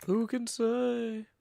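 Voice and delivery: slow, high, mysterious; spooky. Acted. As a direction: drag it out like a ghost tale. Spooky rhetorical question.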